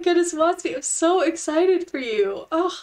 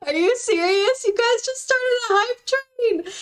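high, overwhelmed squeal; thrilled disbelief; 9 semitones higher than usual. Real, not acted. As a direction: squeal as if you can't believe the gift. Viewers suddenly started a hype train.

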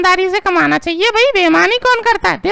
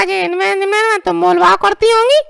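ordinary talk

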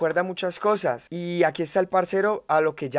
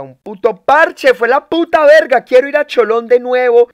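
loud, raised, emphatic enthusiasm; excited raving; 7 semitones higher, 12 dB louder than usual. Real, not acted. Streamer raving about Cholón to viewers, loudly excited. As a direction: belt out your love for the place.

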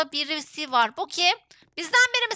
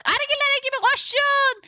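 high rallying cry to the team; calling out; 8 semitones higher than usual. Real, not acted. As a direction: call them to run, pitched high. (ekibe yüksek perdeden seslenme)

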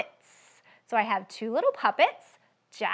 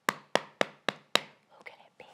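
slow hushed whisper; slow suspenseful whisper; 9 dB quieter, much slower than usual. Acted. Suspense before opening box.

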